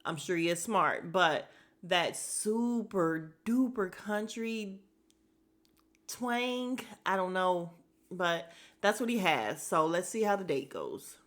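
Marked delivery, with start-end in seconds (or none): none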